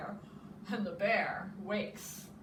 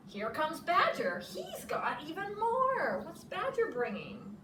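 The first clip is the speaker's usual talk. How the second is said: excited Hare voice; 8 semitones higher, 3 dB louder than usual. Acted.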